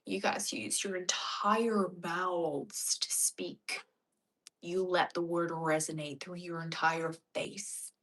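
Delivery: slow American accent with open mouth. Shown as a demonstration. Showing how Americans speak, slow resonant American accent. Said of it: "You'll notice Americans, when they speak".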